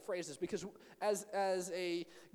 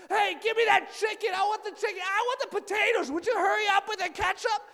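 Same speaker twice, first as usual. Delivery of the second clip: much higher, much louder squabbling shout; siblings yelling; 12 dB louder than usual. Retold or imitated. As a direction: yell over each other for the food at dinner. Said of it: "when there's yelling".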